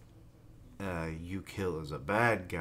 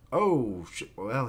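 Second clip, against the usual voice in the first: normal talking voice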